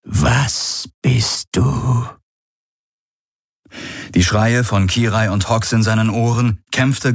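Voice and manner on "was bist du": eerie, very slow drawn-out words, higher pitch; weak, confused voice. A narrator's reading. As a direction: draw each word out like an alien mind. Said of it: "Sie klang schwach und verwirrt". (schwache, verwirrte Geisterstimme, gedehnt)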